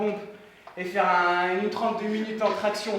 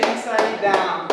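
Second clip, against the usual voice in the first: slow drawn-out cadence; 5 dB louder, much slower than usual. Acted. Coaching rhythm of reps.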